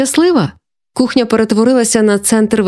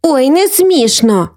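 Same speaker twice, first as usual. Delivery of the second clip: higher, slow and huffy; offended; 7 semitones higher, slower than usual. A narrator's reading. Sister answering her teasing brother, offended. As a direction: drag it out, stung by the teasing. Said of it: "образилася Софійка".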